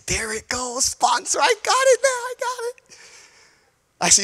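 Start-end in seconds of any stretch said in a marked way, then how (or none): 0.1-3.0 the penny drops, cry it out; excited realization. Retold or imitated. Pastor telling the church how he got the word, excited. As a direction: voice leaps high with sudden understanding.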